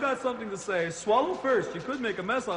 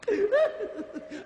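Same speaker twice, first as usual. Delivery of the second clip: high cackle; 12 semitones higher than usual. Acted.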